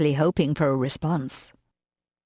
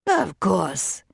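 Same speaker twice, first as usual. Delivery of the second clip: put-on French accent. A narrator's reading. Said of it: "with that strong French accent".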